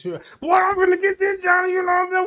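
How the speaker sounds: high, loud eager shout; excited inner shout. Retold or imitated. A man's mind as he approaches the woman, loud eager shout. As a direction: shout it like he can't wait. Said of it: "His whole mind is talking like this".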